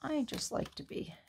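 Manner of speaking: drops to an unvoiced whisper. Real, not acted. Crafter murmuring to herself while working, whispered. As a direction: breathe the words under the breath.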